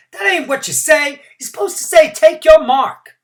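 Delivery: high, loud heckling yell; yelled from the crowd. A narrator's reading. Heckler in the crowd correcting the starter, loud yell. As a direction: correct the starter at the top of your voice. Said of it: "somebody in the crowd yelled".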